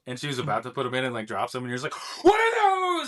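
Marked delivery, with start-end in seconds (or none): 2.2-3.1 a squeaky high, loud shriek of disbelief; shocked kid. Retold or imitated. Little kid seeing grandma's teeth fall out, high shocked squeal. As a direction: recoil like a child who just saw something gross.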